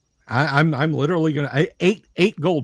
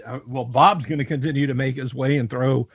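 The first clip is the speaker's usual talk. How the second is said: ordinary talk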